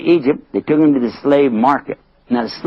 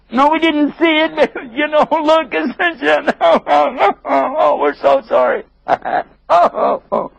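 high, giggling mock sorrow; hammed-up insincere apology with laughs; fake apology. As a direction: pretend to be sorry while stifling laughs.

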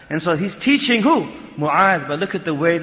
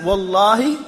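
ordinary talk, nothing marked

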